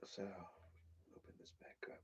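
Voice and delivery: soft unvoiced whisper, slow. Real, not acted. Quietly murmuring while opening the package. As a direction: breathe it out under the breath.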